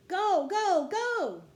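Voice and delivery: high, loud cheering on a racer; excited shout. A narrator's reading. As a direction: shout it out, urging them on. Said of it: "she shouted".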